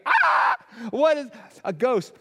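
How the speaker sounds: hammed-up terrified cry; comic girlish scream; mock shriek. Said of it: "shrieked in fear".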